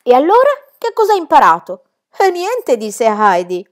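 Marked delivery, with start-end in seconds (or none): 0.0-1.8 very high, loud scandalised cry; rising in shock at each answer; appalled governess. Acted. Rottenmeier reacts to the child's age with rising outrage. 2.2-2.8 put-on offhand child. Child answers.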